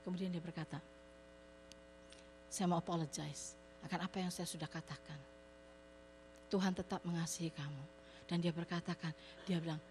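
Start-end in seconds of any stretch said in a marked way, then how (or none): 2.5-7.8 remorseful apology. Retold or imitated. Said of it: "Dia nyesel sekali".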